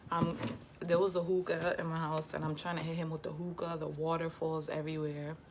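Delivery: normal talking voice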